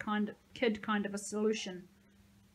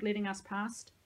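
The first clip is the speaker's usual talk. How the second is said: usual speaking voice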